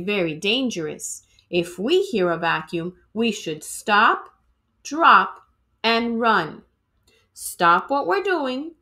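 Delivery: usual speaking voice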